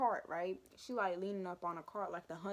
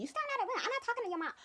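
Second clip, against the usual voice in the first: much higher, snippy teacher imitation; mock teacher; much faster than usual. Student mimicking the teacher who refuses the mom, high and snippy. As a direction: squeak it like a snooty teacher. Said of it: "This is Barbie".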